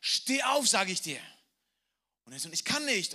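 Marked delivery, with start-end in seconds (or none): 0.0-1.2 much higher and louder, barking order; angry command. Acted. Father orders his tied son to stand, yelling. 2.5-3.1 put-on higher, louder helpless child; son's cry. Tied son answers his shouting father, crying out.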